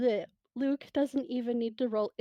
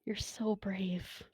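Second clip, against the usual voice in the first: tender whisper. Acted. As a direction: whisper it gently to someone crying. James comforting crying Luke.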